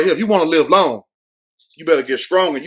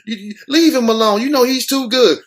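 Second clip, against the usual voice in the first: bystanders pleading; 6 semitones higher than usual. Retold or imitated. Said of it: "They were like".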